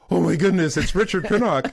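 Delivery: high, loud mock delight; surprised recognition. Al greeting old friend Richard, imitated happy surprise. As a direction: act out Al's sudden recognition.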